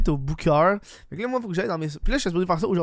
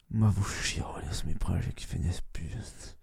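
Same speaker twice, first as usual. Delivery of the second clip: slow breathy whisper; 8 semitones lower, 8 dB quieter than usual. Real, not acted. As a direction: lean into the mic and whisper. Whispered aside to listeners.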